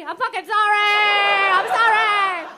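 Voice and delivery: high, drawn-out hammed-up wail; shouted mock apology. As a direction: yell a desperate apology, stretching the words. Mock pleading apology shouted after teasing teacher.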